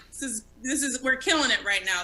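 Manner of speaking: usual speaking voice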